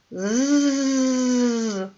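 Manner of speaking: "З" as a drawn-out zzz; mosquito buzz. Shown as a demonstration. Teacher voicing the mosquito's song, soft buzz. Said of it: "яку пісеньку він співає?".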